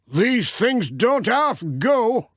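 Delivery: high, slow, hearty country farmer; cheerful workman warning the postman back; Peter's voice. Acted. Farmer telling Pat to stand back, high and hearty. Farmer pleased with his saw.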